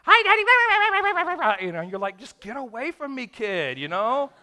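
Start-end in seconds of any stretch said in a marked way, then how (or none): 0.0-1.7 put-on very high, slow, sweet child's greeting; a child happily greeting daddy; little kid voice. Child greets tired father. 2.2-3.8 higher, curt brush-off; a touch of a grumpy tired father snapping at his child; lightly voiced irritated dad.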